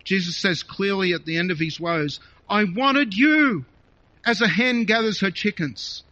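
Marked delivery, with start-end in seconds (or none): 2.5-6.0 pleading cry. Acted. Jesus's lament voiced with passion.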